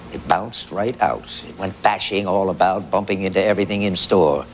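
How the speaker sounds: ordinary delivery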